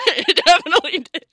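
laughing hard, high; loud laughter. Real, not acted. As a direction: crack up.

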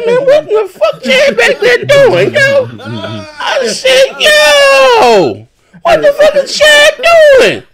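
shocked laughing shout. Retold or imitated. Streamer hearing Chad's fight on live, shrieking with laughter. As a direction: shriek it out in disbelief, cracking with laughter.

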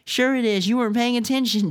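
high-pitched squabbling complaint; complaining. A narrator's reading. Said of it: "Dylan complained".

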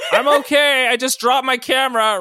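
loud, high yell over the wind; yelled from a tornado. Acted. Ryan inside the tornado yelling to his friends, loud high yell. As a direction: shout it from far inside a storm. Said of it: "yelled out in response from inside a tornado".

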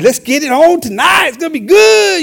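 high, loud hyped-up shout; excited radio shout. Retold or imitated. Radio host hyping tonight's service, excited shout. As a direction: fire up the listeners. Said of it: "all excited on the radio".

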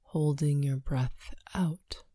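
ordinary delivery

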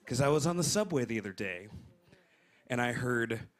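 ordinary delivery

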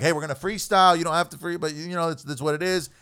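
retelling his warning to guests; retold reminder. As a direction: mimic telling the guest plainly. Quoting himself to guests.

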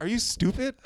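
high-pitched, incredulous comeback; mock indignation. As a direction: throw it back as an incredulous question. Retold indignant reply to strangers.

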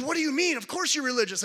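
mock incredulous stranger; incredulous man. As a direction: play the stranger who can't believe it. Man insisting pastor is religious.

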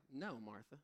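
softly voiced Jesus calling Martha to rest; gentle Jesus. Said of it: "Jesus was like".

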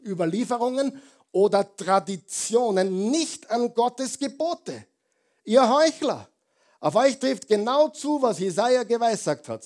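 loud, high preacher's climb; rising into a fervent, loud sermon peak; full-force preaching. Acted. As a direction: drive it home to the congregation. Preacher thundering Jesus' rebuke.

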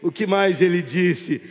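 projected rhetorical question; emphatic pulpit demand; insistent question. Real, not acted. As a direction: push the question out firmly to the congregation. Repeats question, building to climax.

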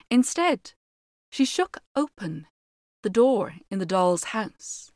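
measured fairy tale telling; story narration. A narrator's reading. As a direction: read it like a storyteller by the fire. Reads fairy tale aloud.

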